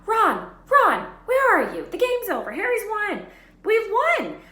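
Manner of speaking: very high, loud, joyful shrieks; shrieking. Acted. Hermione celebrates Gryffindor's win, looking for Ron: shrieking. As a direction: shriek with victory. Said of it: "shrieked Hermione".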